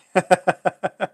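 rapid run of chuckles; real amused chuckle. Real, not acted. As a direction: laugh along after teasing a mate. Teasing Mike about starting it.

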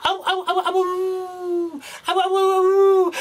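high, drawn-out wolf howls; howling wolves. Acted. Wolves near the little lamb, howling. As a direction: howl close by the lamb. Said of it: "the wolves were near the little lamb going".

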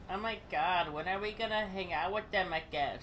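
imitating his lady; girlfriend voice. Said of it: "She don't talk nothing like that".

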